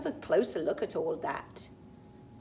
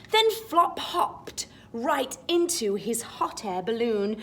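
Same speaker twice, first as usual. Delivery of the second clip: rising, excited read-aloud, higher on moon; storybook reading; 6 semitones higher, 8 dB louder than usual. Acted. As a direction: build the rhyme up to the moon. Reads the picture book rhyme.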